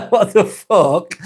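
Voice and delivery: laughing out a high, incredulous what the fuck; laughing disbelief. Real, not acted. Streamer laughing at a chat message, real amused disbelief. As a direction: crack up mid-question, slowed by laughter.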